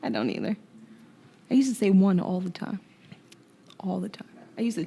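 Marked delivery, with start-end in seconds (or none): 3.8-4.3 breathy whispered aside; whispering. Real, not acted. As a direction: drop to a whisper. Emphatic aside repeated.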